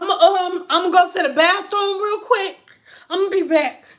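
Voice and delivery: ordinary talk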